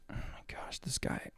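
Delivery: exasperated whisper under the breath; whispered aside. Real, not acted. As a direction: breathe it out in disbelief, barely voiced. Muttering aside at the clip.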